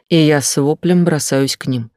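plain reading voice

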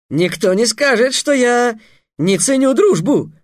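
high, loud, grand declaration; proud reply. A narrator's reading. Slave trader defending his price to Trix, proudly. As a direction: proclaim it with injured dignity. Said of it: "гордо ответил работорговец".

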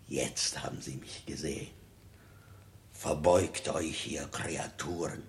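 low, soft sneer; contemptuous murmur. Acted. King sneering softly at the fawning courtiers. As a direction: mutter it with scorn.

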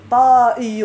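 طائي loud, long aa then a glottal stop; irregular form. Shown as a demonstration. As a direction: hit طائي loudly as ṭaa-iyyun. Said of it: "هذا شذوذا".

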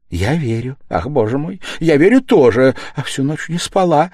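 distraught mother crying out. Acted. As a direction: blurt out the sleepless night. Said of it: "вскричала бедная женщина".

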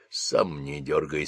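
low, soft, slow hiss through gritted teeth; captain hissing at the sergeant through gritted teeth. Acted. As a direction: snap back under the breath. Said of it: "сквозь зубы прошипел капитан".

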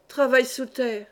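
plain reading voice

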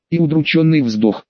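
usual speaking voice

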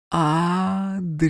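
put-on high, hushed little child calling; a ghostly child breathes the name; child's whisper. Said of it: "прошептал детский голос".

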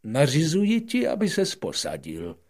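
loud, high king granting permission as a command; royal order. Acted. King ordering the prince to sit, loud and high. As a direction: command him to sit grandly. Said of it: "odpověděl král".

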